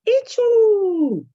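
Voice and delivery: sudden scream, very high and loud; a cry of pain from inside the monster; one sharp scream of pain; person screaming. A narrator's reading. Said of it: "he heard a screaming of a person".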